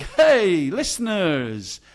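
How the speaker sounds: cheesy local radio host. Retold or imitated. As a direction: ham up a small-town DJ. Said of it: "like a local radio D J".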